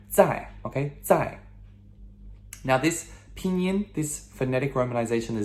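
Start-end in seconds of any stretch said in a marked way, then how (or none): 0.1-0.5 zai pitched high, then dropping; a sharp fourth-tone zai; descending zai. Shown as a demonstration. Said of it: "descending tone". 1.0-1.4 Zai high, with a sharp fall; descending Zai. Shown as a demonstration. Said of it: "descending tone".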